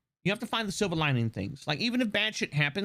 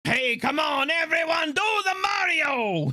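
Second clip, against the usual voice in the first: cartoon Mario shout; 8 semitones higher, slower than usual. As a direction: whip up the crowd like cartoon Mario. Quoting the cartoon dance call.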